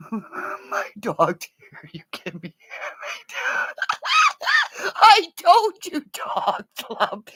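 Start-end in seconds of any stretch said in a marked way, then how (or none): 2.7-4.9 very high shrieking laugh. Real, not acted.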